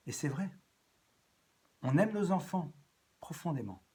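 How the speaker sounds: ordinary talk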